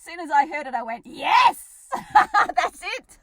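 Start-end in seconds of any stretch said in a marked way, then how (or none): 1.1-3.2 loud delighted laughing yes; excited. Real, not acted. Host cheering loudly over a comedy gift, delighted and laughing.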